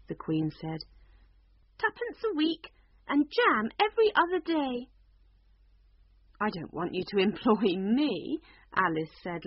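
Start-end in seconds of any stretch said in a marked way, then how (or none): 1.8-4.9 high, louder queen stating her terms; a dotty queen making a generous offer. Acted. 6.4-8.4 a laugh breaking into the refusal; amused, laughing it off. Acted. Alice refusing the Queen's job offer, laughing.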